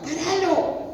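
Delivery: high-pitched mock cry to stop; imitated yelling. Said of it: "le gritaba". (grito agudo imitado)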